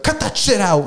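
high-pitched mock outburst; mock telling-off. Gym guy warning off crackheads, mock telling-off.

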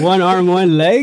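can't believe what he just heard; stunned disbelief. Real, not acted. Host asking the guest about her one-armed teacher in stunned disbelief.

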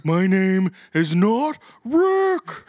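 loud, very slow dopey cartoon voice; Patrick Star impression. SpongeBob fan quoting Patrick, slow and dopey. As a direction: drag it out like a slow cartoon starfish. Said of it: "make me sound like Patrick".

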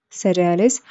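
usual speaking voice

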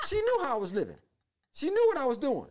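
very high, marveling exclamation; amazed exclamation. Real, not acted. Exclaims in amazement, after laughing.

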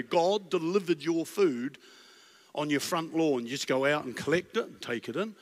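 usual speaking voice

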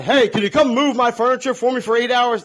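high, loud mooching ask; pushy favor request. Retold or imitated.

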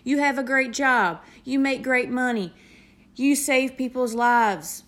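imitating people insisting you have it all; mock preachy outsiders. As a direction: parrot what everyone tells you, a bit preachy. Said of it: "Everybody looks at you and they say".

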